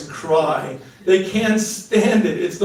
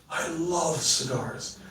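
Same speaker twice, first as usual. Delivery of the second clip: slow and much softer, love leaned on; softer take; 9 dB quieter, slower than usual. Shown as a demonstration. Second take for the audience, slow and hushed. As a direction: let it drop to a hush. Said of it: "Watch this".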